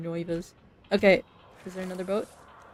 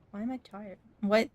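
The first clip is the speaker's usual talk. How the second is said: ordinary talk, nothing marked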